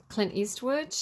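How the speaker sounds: ordinary talk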